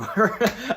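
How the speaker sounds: high, loud laughing fit; burst of laughter. Real, not acted. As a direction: crack up at your own joke. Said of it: "I'm cracking up".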